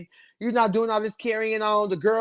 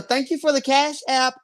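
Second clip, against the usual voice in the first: usual speaking voice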